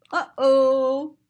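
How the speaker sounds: ordinary delivery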